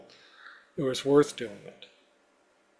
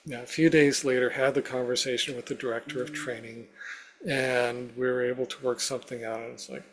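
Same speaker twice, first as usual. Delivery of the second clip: ordinary delivery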